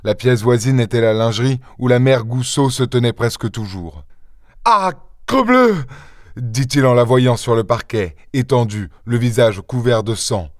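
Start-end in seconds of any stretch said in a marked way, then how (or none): 4.6-5.8 high, drawn-out cry of shock; shocked oath. A narrator's reading. Son finding his mother on the floor, shocked oath. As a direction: swear in alarm, slow and high.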